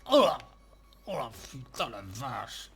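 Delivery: nauseous, retching between groans; dry heaving. Acted. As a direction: gag and groan, about to vomit. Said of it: "j'ai envie de vomir".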